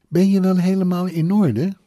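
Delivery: high, loud incredulous question; indignant disbelief. A narrator's reading. Mother reacting to her son's orders, indignant disbelief. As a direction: question his sanity, pitch well up.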